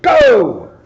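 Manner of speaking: high, loud shout of Go; starting shout. Acted. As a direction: yell it like a race starter.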